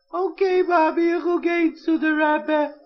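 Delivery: put-on high childlike reply; a small boy deciding bravely; hint of a sniffling child; little boy's voice. Child answers mother.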